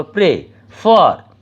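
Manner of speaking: Pray, ফার slowly split, weight on ফার; slow demonstration of pray, ফার with strong ফার; stress on last syllable. Shown as a demonstration. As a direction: pause after a light pray, then hit ফার. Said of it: "একটু silently যেন চলে গেলো".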